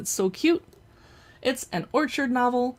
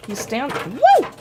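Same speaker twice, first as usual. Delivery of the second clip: excited woo; 4 semitones higher, 8 dB louder, slower than usual. Real, not acted. Doll stands up on its own.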